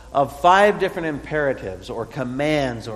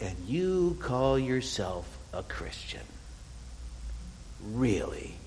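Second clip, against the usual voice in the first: mock accusing whisper; 3 semitones lower, 7 dB quieter, slower than usual. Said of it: "this whisper of".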